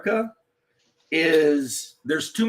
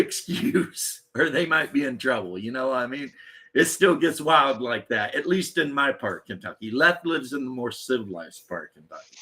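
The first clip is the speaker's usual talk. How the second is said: usual speaking voice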